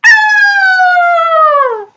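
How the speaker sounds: long, very high scream; acted falling cry, held high; high mock scream. A narrator's reading. Reader plays trouble in hot air balloons, high scream.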